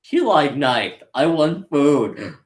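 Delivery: slow, simple-minded character voice; drawn-out, childlike plain wants; Broly. Retold or imitated. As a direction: imitate a naive brute, word by word. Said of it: "Broly just like".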